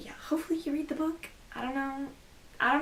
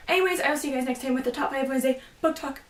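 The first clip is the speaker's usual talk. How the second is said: normal talking voice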